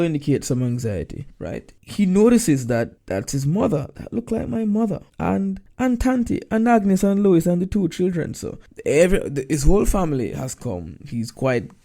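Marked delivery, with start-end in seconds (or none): 4.0-8.3 much higher, rising astonishment; surprised recognition. Acted. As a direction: call out each name in disbelief. Reading Tolroy's shocked recognition.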